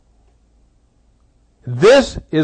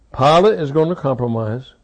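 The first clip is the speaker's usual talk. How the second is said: normal talking voice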